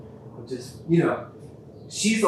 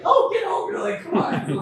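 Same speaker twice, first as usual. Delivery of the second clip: very high, playful brush-off; mock exasperation; 4 dB louder than usual. Man retelling his reply to his tearful partner, playfully exasperated. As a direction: wave it away in a squeaky, louder pitch.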